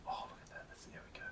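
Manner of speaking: hushed aside to the canvas; whisper. Real, not acted. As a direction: breathe it out softly, almost no voice. Soft aside while painting.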